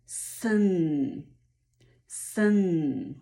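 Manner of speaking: Sen as "sun" with long S and humming n; drawn-out first syllable. Shown as a demonstration. Teacher joins the three sounds, stretched-out "sun". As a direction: blend Sen slowly, stretching both consonants. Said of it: "trzy najważniejsze dźwięki w pierwszej".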